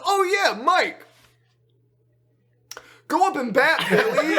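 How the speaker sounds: high, loud, breezy mock brush-off; hammed-up oblivious umpire; acting out Uncle Al. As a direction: call it out high with laughter underneath. Imitating Uncle Al brushing off question.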